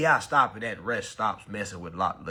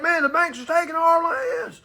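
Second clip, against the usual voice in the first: high shouted complaint from the farmers; imitated angry farmer outcry; mock farmer shout; 5 dB louder than usual. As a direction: yell it like a fed-up farmer losing his land. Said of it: "They're like".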